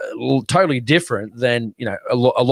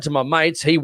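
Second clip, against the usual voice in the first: ordinary talk, nothing marked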